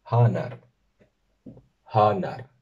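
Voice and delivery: "hanar" with two clear As; teacher modelling the first form of brother; Sindarin word. Shown as a demonstration. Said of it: "que tiene dos formas aceptadas".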